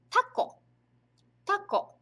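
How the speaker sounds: tako, said clearly as TAH-ko; teacher models tako slowly for students; Japanese word tako. Shown as a demonstration. Teacher modelling Japanese tako, careful pronunciation. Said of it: "em japonês, a gente chama de".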